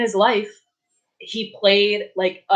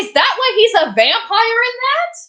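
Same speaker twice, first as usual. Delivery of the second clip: much higher and louder, amazed; excited realization; 13 semitones higher, 8 dB louder than usual. Real, not acted. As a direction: blurt it out in delight.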